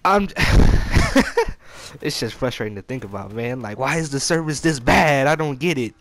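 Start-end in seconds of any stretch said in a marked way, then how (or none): 0.3-2.0 high laugh. Real, not acted. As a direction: burst of high airy laughter. Laughs after rob joke setup.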